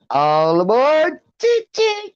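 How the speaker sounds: drawn-out "all aboard" rising into high toots; playful conductor call climbing high; imitated train call. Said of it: "every day I listen for".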